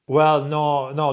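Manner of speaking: ordinary talk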